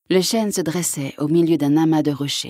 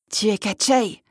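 higher cry of recognition; startled exclamation; 4 semitones higher than usual. A narrator's reading. Young prince recognises his enemy, exclaiming. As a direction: exclaim as you realise who it is. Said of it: "s'écria Ivan".

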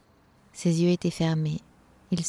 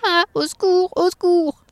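high, loud cries for help; acted high-pitched panic; panicked elves crying out; 12 semitones higher, 6 dB louder than usual. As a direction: squeal in alarm like frightened elves. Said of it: "crièrent les lutins".

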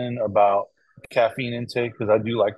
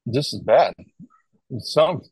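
ordinary talk

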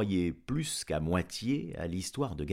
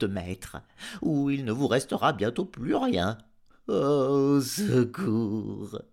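howling dog; 10 semitones higher, 7 dB louder than usual. A narrator's reading. Dog telling master of the trampled farm, howling. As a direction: howl the news like a frantic dog, stretching the cries. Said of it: "Garme se mit à hurler".

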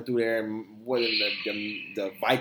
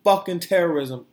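louder and higher, punching each word; loud outburst; 6 semitones higher, 6 dB louder than usual. Real, not acted. Host angrily calling out the Capitol riot, loud. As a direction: spit it out in outrage.

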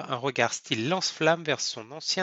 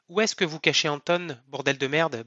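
plain reading voice

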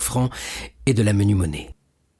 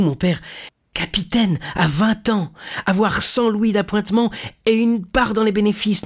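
higher, building elated delivery; eager young sailor son; 8 semitones higher than usual. Acted. Young sailor telling his father he will be captain, eagerly. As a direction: rise from earnest relief to high-pitched wonder.

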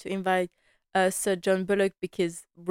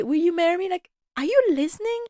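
higher, mocking his proposal; exasperated; 10 semitones higher, 3 dB louder, faster than usual. Real, not acted. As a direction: scoff at him in disbelief.

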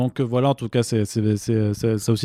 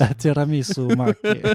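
higher, hammed-up Italian accent; playful mock Italian outburst; 4 semitones higher than usual. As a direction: exclaim like a caricature Italian. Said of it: "faire mon petit accent italien aussi".

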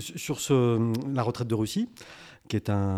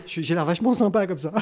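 ordinary delivery

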